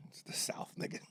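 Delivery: unvoiced conspiratorial whisper; whispered punchline. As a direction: lean in and breathe it like a secret.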